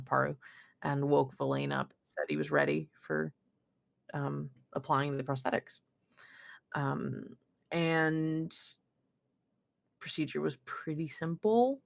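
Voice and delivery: ordinary delivery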